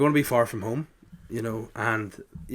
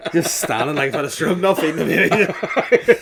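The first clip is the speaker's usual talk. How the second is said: laughing; 5 semitones higher, 7 dB louder than usual. Real, not acted. Laughing recalling night feeds.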